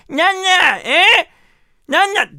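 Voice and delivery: high, loud Kumamoto granny; old farming granny. Retold or imitated. Granny asks receptionist where rakugo is, loud old-woman voice. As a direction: badger loudly like a hard-of-hearing old woman. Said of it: "そのおばあちゃんが".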